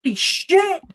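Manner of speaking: high, loud alarmed cry; shocked yell. Acted. Racer finds rival right behind her, loud high shock. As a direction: shriek it as the startled racer.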